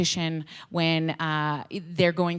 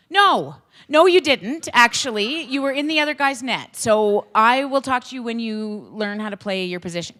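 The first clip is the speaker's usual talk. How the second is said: mock stern coach; 4 semitones higher, 7 dB louder than usual. Coach voicing himself scolding kid.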